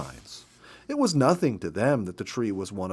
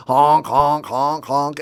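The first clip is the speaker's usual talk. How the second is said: porcupine honks; 3 semitones higher, 9 dB louder, slower than usual. Retold or imitated. Porcupines interrupt the reading.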